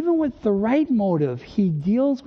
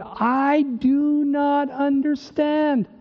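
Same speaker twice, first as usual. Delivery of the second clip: slow, higher, drawn-out bewilderment; baffled; 7 semitones higher, much slower than usual. Acted. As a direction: stretch each word in baffled frustration. Acting baffled believer quoting verse.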